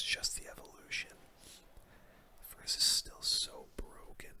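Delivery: normal talking voice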